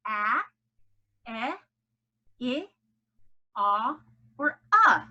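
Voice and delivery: "a, eh, I, ah, uh" as separated short vowels, as in cat, bed, sit, hot, cup; isolated phonics vowels "a, eh, I, ah, uh"; short vowel sounds. Shown as a demonstration. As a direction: give each short vowel alone, paused between. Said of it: "a vowel in a closed syllable is short".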